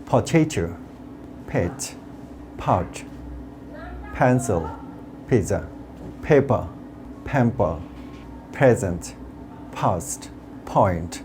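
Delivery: each P puffed, from "Potato" to "point"; word-list drill with airy P, as in "paper"; aspirated P words. Shown as a demonstration. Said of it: "এটার উচ্চারণটা হচ্ছে". ("pencil", "paper"-এর প ফ-এর মতো)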